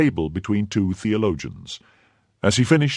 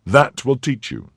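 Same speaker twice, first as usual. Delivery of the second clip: stern rebuke; 7 semitones higher, 4 dB louder than usual. Acted. Angry rebuke of the host.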